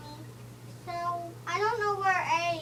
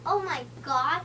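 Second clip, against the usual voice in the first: ordinary delivery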